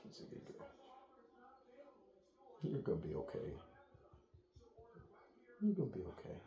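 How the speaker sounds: soft, hushed reassurance; gently calm the dog down; soothing the dog. Real, not acted. Trainer softly reassures the nervous dog, hushed and gentle.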